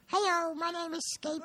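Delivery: high tiny cartoon voice; squeaky little cat talking. Shown as a demonstration. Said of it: "give us a sample of what that would sound like".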